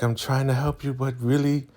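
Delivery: mock pleading helper. Zane to Elder Mother, insisting she helps, mock plea. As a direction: raise the voice into a mock earnest plea. Voices Zane answering the victim.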